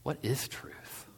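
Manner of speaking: hushed, drawn-out question. A narrator's reading. Pilate questioning Jesus about truth, hushed and slow. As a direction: ask it softly and slowly, almost whispered. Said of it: "And Pilate questioned".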